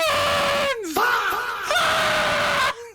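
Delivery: long high-pitched cackling burst; loud shrieking laugh. Real, not acted. Streamer cracking up at the blondes clip, shrieking laugh.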